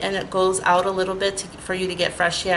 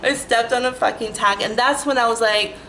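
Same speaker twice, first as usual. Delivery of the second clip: ordinary talk, nothing marked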